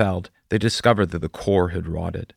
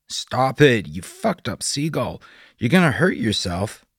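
loud, high, frantic shouting; frantic yell; 6 semitones higher, 3 dB louder than usual. Acted. Doug shouts at the gull pecking his windshield, loud and frantic. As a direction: yell at the crazed bird in a panic.

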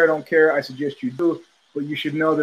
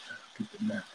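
"I beat the meth" whispered, much softer; whispered repeat; 19 dB quieter, much faster than usual. Retold or imitated. Quoting his friend, repeating it in a whisper. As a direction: breathe the repeat as a hushed aside.

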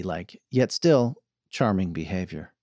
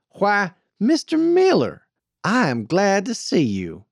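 high, drawn-out Western welcome; loud, lilting hello; effusive greeting; 9 semitones higher, 4 dB louder than usual. A narrator's reading. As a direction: greet him with sugary gladness. Hailing a driver she needs.

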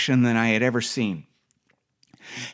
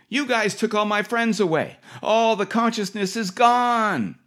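pitched way up, ranting wildly; speedy woman railing at staff about her lost friends, high rant; 10 semitones higher than usual. Retold or imitated. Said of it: "she railed".

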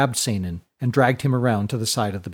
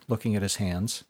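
usual speaking voice

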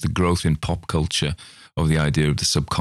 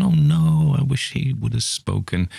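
high, put-on wail of a disappointed listener; "oh no" squealed up high, then a sulky sigh; disappointed fan; 8 semitones higher than usual. Listener mourning the missing Australian accent, mock dismay. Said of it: "you've gone".

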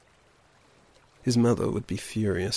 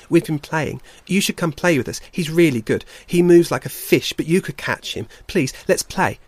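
excited little brother, higher and louder; eager pleading; 4 semitones higher, 6 dB louder than usual. Acted. Little bear begs his brother to join the game, excited.

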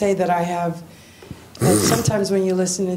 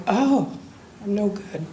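light acceptance; 2 semitones higher, 3 dB quieter than usual. Retold or imitated. Telling the host how she sees herself now, lightly accepting. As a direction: toss it off lightly, no sting. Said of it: "But now I'm like".

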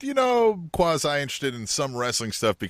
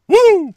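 loud high-pitched woo; big celebratory "woo"; excited whoop; 14 dB louder than usual. Real, not acted. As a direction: cheer with a sudden piercing whoop. Celebratory hype cheer for wrestler.

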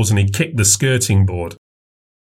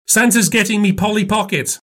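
put-on little girl voice; 11 semitones higher than usual. A narrator's reading. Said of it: "said Maisie".

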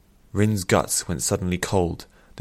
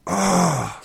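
high, loud cry of frustration; frustrated cry; 11 semitones higher, 5 dB louder than usual. A narrator's reading. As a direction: cry out in exasperation. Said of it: "cried out with frustration".

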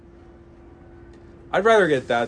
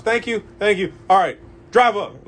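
brisk exchange; 6 semitones higher, 6 dB louder than usual. Retold or imitated.

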